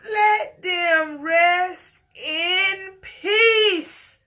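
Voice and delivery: real tears, voice climbing and breaking; crying. Real, not acted. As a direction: sob it out, begging them to stop. Emotional plea for Jackson family.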